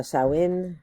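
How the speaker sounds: Samhain said "SIGH-n" as one syllable; Samhain pronounced SIGHN; alternative pronunciation. Shown as a demonstration. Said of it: "which can also be pronounced".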